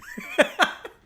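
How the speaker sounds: high, helpless laugh; can't hold the laugh in; real burst of laughter. Real, not acted. Streamer cracking up at his own kangaroo story, laughing hard.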